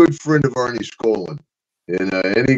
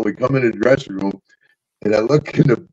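ordinary talk, nothing marked

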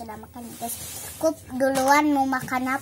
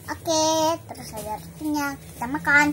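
ordinary talk

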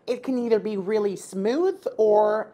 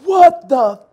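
disgusted; 8 semitones higher, 5 dB louder, much slower than usual. Real, not acted. Reacting to awful cherry.

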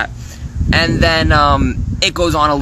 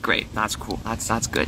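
much softer, flat-toned fake cheer; deflated sarcasm; 5 semitones lower, 10 dB quieter than usual. Retelling reading a fan's DM, soft deflated sarcasm. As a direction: say it like it's anything but great. Reacting to sad DM.

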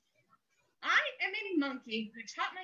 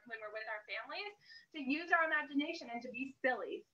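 ordinary delivery

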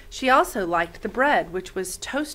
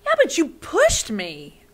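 put-on young girl protesting; 8 semitones higher, 5 dB louder than usual. Lena defends herself in quarrel.

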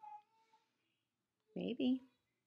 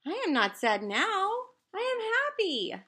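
louder, rising high on happy; happy reassurance; 7 semitones higher, 6 dB louder than usual. Acted. Gerald telling Piggy he is happy now, louder. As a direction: beam with joy.

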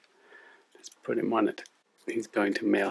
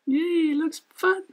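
squeaky, high cartoon puppet sidekick; chirpy little puppet, much higher; 3 dB louder, slower than usual. Puppet co-host Lani speaks.

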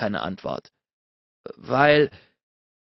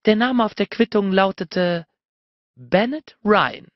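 higher woman's voice, slowing on the name; triumphant reveal; 7 semitones higher than usual. A narrator's reading. She reveals the name on the receipt, slow triumphant reveal. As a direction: draw out the name as a gotcha.

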